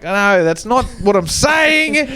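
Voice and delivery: high exasperated protest; pleading that it's only a joke; protesting self. Retold or imitated. To the fuckwits online, a high exasperated protest. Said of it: "And I'm like".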